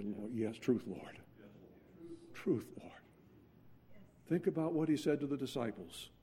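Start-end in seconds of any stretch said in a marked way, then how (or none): 0.0-2.9 humble confession. Acted. Humble confession voiced to God.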